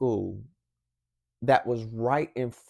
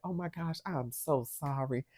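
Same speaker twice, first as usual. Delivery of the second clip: much softer, sheepish apology; imitated quiet, contrite customer; hushed apology; 13 dB quieter than usual. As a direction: drop the volume and apologize, embarrassed. Embarrassed mother apologizing at window.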